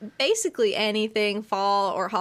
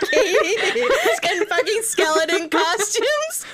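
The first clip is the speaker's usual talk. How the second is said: laughing; 7 semitones higher, 4 dB louder than usual. Real, not acted. Laughing at silly joke.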